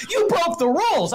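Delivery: shrill mock of someone crying foul; whiny complaint. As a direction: squeal it like a tattling kid. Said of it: "I'm not crying foul".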